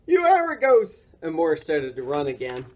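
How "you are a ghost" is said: loud, high, spooked exclamation; frightened yell. A narrator's reading. Morris believing Boris is a ghost, frightened yell. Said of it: "Morris yelled".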